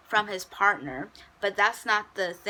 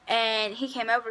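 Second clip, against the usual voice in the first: ordinary talk, nothing marked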